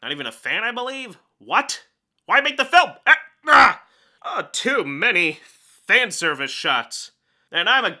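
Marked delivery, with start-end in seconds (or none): 3.1-3.8 startled cry. Real, not acted. Reacting in shock to the film.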